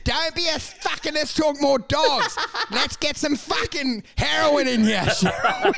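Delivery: high, laughing insistence; pushy host. Acted. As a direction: push Cheryl harder, laughing.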